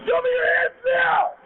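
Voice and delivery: urgent commanding shout; shouting commands. Real, not acted. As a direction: yell police commands at full force. Officer shouting commands again.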